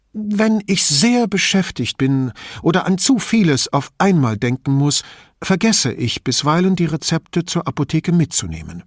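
put-on old lady, indignant and chatty; old signora. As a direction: play the suspicious old woman complaining about thieves.

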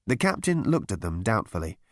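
usual speaking voice

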